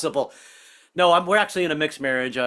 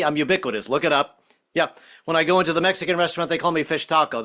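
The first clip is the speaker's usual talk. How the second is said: normal talking voice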